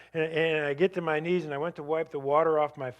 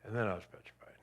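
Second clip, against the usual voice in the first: whispered, breathless with remembered fear; hushed whisper; 4 semitones lower, 7 dB quieter, faster than usual. Real, not acted. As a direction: drop to a frightened whisper. Recalling terror after friend's reaction.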